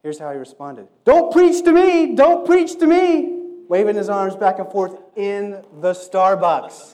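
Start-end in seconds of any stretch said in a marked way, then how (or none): 1.0-3.4 much higher, much louder, slow shouted refusal; angry shout. Retold or imitated. Man shouting at preacher. 3.7-6.9 loud telling. A narrator's reading. Still in the shouted voice.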